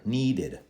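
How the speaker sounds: NEEDED as need-id, two syllables; extra id ending. Shown as a demonstration. Teacher asking students to repeat, id ending. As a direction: end NEEDED on a clear id. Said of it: "Hear the extra id at the end".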